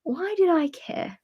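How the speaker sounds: slow high why did I care; rueful disbelief. Retold or imitated. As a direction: stretch it out, amused at your younger self. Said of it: "you're just like".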